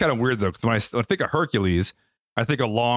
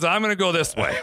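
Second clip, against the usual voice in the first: laughing through a higher mock announcement; laughing mock line; 6 semitones higher than usual. Host imitates the actor's choice, laughing. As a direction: deliver it with a laugh, hammed-up. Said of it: "he goes".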